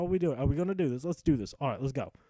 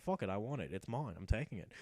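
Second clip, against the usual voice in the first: softer, lower, possessive grumble; low greedy mutter; 6 semitones lower, 7 dB quieter than usual. Acted. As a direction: keep it to yourself, covetous. Hrothgar greedily claims useless item.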